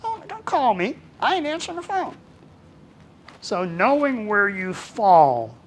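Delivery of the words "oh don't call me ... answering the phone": high, exasperated mock complaint; hammed-up irritation at a phone call; annoyed. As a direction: brush off the caller. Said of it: "and I'll say".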